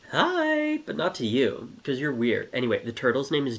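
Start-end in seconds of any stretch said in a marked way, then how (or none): 0.1-0.8 high, loud squeaky hi; turtle friends' greeting. Acted. Other turtles greet each other, high and loud. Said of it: "they were like".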